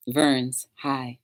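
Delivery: low, soft, put-on hello; a lightly voiced pretend conversation voice; odd put-on greeting. Greeting viewer Verns in a soft low put-on voice. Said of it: "Why am I talking like that?".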